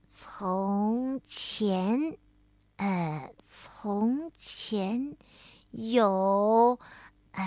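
slow, stumbling word-by-word reading; reading aloud haltingly. Acted. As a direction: sound out each word like a beginner. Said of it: "慢慢地一个字一个字地念".

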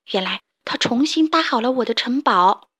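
usual speaking voice

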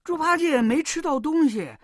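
ordinary delivery, nothing marked

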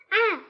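short loud pained "ah"; pained cry. Acted. Pooh yelps as Rabbit pulls his paw. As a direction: yelp as if your paw is yanked. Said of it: "维尼惨叫一声".

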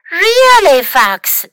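loud, high first word, dropping on the name; acted storybook reproach; exasperated disbelief. A narrator's reading. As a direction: take Fox to task in disbelief. Carmen reacts to Fox's fib.

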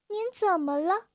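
mildly worried question; uneasy. Acted. Carmen asking the crying grandpa, mildly uneasy. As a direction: ask with a touch of unease. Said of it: "卡门不安地问道".

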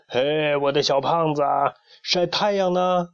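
high, lingering doting greeting; fondly affectionate. A narrator's reading. Rooster grandpa greets the little hen, fond. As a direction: coo it kindly, stretching the question. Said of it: "公鸡爷爷慈爱地问".

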